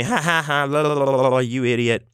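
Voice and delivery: high, babbling mock laugh and taunt; mocking laughter. Friends laughing at him as he pukes, mocking jeer. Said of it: "Everyone's laughing at me".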